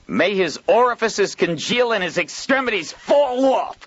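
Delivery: high, loud furious curse; shouting curse. A narrator's reading. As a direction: bellow it at full voice. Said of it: "Joel cried at the top of his voice".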